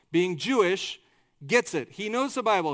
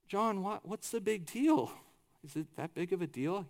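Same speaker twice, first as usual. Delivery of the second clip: softly voiced puzzled onlookers; puzzled questioning; 9 dB quieter than usual. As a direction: quietly question why it matters so much. Voicing puzzled listeners questioning John.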